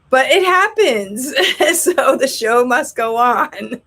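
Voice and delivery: laughing it off. Real, not acted. Teacher laughing off a student chewing in class, amused resignation. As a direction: shrug it off through real laughter.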